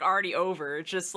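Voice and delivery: ordinary talk, nothing marked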